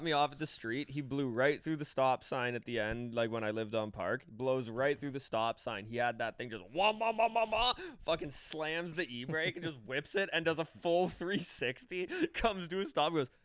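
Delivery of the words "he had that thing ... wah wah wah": imitated engine whine, rising higher; mock engine wah. Friend retelling a wild drift, mock engine whine. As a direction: buzz out a mock engine rev. Imitating car noise in story.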